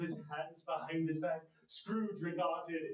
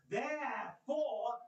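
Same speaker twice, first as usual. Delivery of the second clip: Therefore stretched slow and higher; drawn-out suspense; 5 semitones higher, much slower than usual. Acted. Scrooge teasing Bob before good news, slow therefore. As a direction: hold the tension before the surprise.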